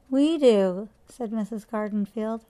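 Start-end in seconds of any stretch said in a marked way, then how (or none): 0.1-0.9 louder, curt comeback; huffy retort. A narrator's reading. Neighbour answering Missus Gray in a huff, curt. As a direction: snap back offended. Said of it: "hung up the phone in a huff".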